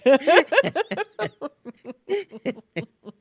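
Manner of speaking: high, loud burst of laughter. Real, not acted. Loud laugh after joke.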